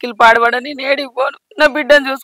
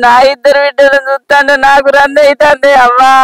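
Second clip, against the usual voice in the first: crying mother's sing-song keening; tearful lament. Acted. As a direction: lament through sobs, stretching the line endings into a wail. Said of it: "ఎందుకు ఎడుతున్నావ్".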